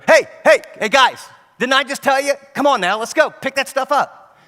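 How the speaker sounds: a fed-up parent yelling; exasperated yell. Retold or imitated. Parent yelling at dawdling kids, exasperated yell.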